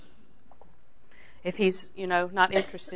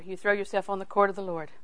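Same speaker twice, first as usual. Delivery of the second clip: normal talking voice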